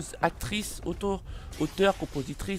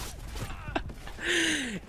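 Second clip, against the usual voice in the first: laugh; 11 semitones higher, 5 dB quieter than usual. Real, not acted. Laughs at start of video.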